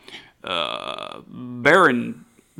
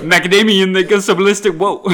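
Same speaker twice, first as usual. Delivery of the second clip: laughing; 6 semitones higher, 8 dB louder, faster than usual. Real, not acted. Laughing mock rap.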